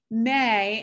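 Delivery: may stressed, held a little longer. Real, not acted. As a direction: lean on the word may to hedge. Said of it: "and I emphasize the word may".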